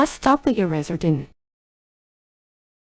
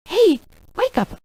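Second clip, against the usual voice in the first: calling out; 9 semitones higher, 6 dB louder, slower than usual. Acted. Waking sleeping hero.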